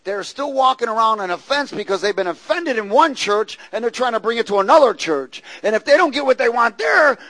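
loud, rising preaching; fiery preaching. Real, not acted. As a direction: build the sermon to full force. Preaching at full force.

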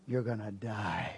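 low, quiet ominous pronouncement; devil's doom taunt. Retold or imitated. Enemy tells woman her fate, low and ominous. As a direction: draw out the threat low and soft.

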